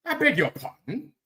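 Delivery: high, affronted British voice; mock-offended Brit; mock British accent. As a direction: put on a hammed-up, high-pitched British protest. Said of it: "They like that shit".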